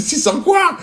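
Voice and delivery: high, loud outraged "six cents quoi"; shouting at the locksmith over the price; indignant shout. Retold or imitated. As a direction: bark back in shock at the price. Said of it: "Et je m'énerve".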